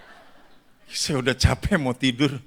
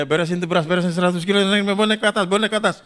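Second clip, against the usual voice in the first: higher-pitched bustling instructions; mock hurried orders; 5 semitones higher, 4 dB louder, much faster than usual. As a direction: boss the workers about, rising as you go. Acts out hurrying workers with rice. (menyuruh pekerja dengan buru-buru)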